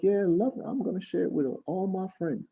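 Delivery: imitated keen listeners. People excited about the Airbnb, high imitated voice. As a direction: lift the pitch to mimic their keen reply. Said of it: "They're like".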